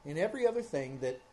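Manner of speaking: ordinary talk, nothing marked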